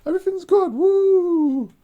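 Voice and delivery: live only for the moment, whooping; carefree party girl. Retold or imitated. Vlogger mocks a woman living in the moment with loud high whoops. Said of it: "in the now and like".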